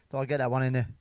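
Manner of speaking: ordinary talk, nothing marked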